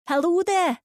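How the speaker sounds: high, loud friendly call across the garden. A narrator's reading. Old lady calling to Lucy over the wall, cheery. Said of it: "she calls".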